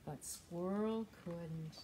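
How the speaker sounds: storytime read-aloud; reading to children. Acted. Librarian reads a story to young children. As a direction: read the picture book to little ones.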